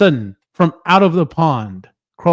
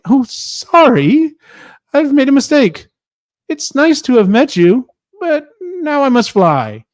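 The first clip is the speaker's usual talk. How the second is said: scared dragon; 10 semitones higher than usual. Acted. Dragon apologising to the beast, high nervous squeak. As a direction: back off trembling and polite.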